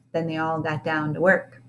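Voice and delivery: plain reading voice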